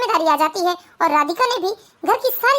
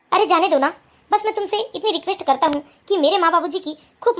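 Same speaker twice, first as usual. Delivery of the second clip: lower, calm husband voice; lowered male voice, steady; 3 semitones lower than usual. Acted. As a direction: explain patiently to your new wife. Husband asks wife to care for parents.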